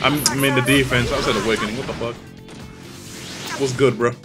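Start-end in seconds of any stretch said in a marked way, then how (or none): none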